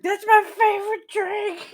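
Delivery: very high, squeaky put-on voice; comic falsetto drunk; drunken delight. Voicing drunk character's delighted outburst.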